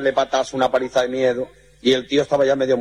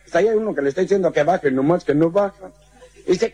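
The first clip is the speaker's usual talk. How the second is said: ordinary talk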